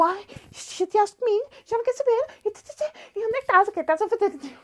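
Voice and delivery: high, fast nagging clingy voice; needy partner firing questions; whiny clingy partner. Retold or imitated. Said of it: "manda trinta, quarenta mensagens".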